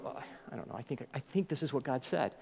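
hushed, low, unsure mumble; mock-sheepish, nearly whispered answer; feeling stupid. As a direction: give up explaining and admit it feebly. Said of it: "You feel stupid".

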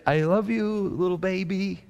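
put-on a toddler comforting her dad; little daughter's voice. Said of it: "she said".

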